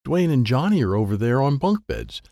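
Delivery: usual speaking voice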